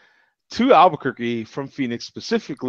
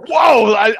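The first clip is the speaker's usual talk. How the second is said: ordinary delivery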